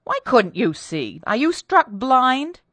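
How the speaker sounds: loud questioning, climbing high; exasperated demand. A narrator's reading. Mistress cross-questions her maid, exasperated.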